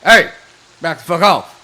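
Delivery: loud, high in-character shout; intimidating yell. Pretend. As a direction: yell it out to scare them off. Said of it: "yell out real loud".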